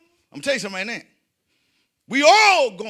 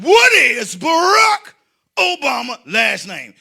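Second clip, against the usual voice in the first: slow, loud, exaggerated repeat; 4 semitones higher, 9 dB louder, slower than usual. Comic repeating the quiz question on request, loud and slow. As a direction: spell it out slowly at a high shout. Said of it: "but say it slow".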